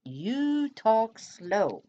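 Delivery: hammed-up slow talk; exaggerated slow drawl. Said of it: "here's another one".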